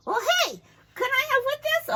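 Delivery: high, loud eager puppet; a puppet asking to borrow the mask. Acted. Puppet asks teacher.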